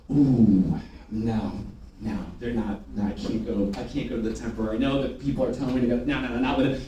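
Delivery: low, soft, reluctant muttering; scared little bunny. Acted. Trainer acting out sellers afraid of premium bedding, mock-timid. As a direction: shrink back in mock timidity. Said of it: "You're scared little bunnies".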